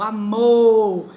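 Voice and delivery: amor with a loud, long final mor; stressed last syllable. Shown as a demonstration. Teacher showing the strong final syllable. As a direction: punch mor at the end of amor. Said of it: "sílaba mais forte".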